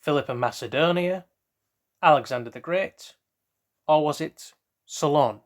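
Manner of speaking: bright quiz-host read, options spaced out; quizmaster reading. Acted. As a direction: read it like a quiz show question. Reads out quiz question and options.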